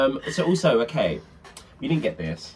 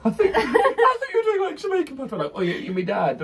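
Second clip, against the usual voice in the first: laughing; 13 semitones higher than usual. Real, not acted. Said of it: "Does it speak in patois".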